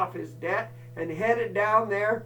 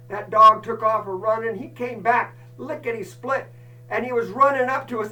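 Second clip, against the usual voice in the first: ordinary talk, nothing marked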